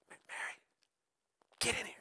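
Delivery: hushed, voiceless summons; urgent whisper. Pretend. Annoyed sister calling Mary in from the side, whispered. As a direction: whisper it so only she hears.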